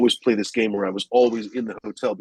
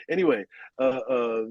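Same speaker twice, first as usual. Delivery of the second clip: ordinary delivery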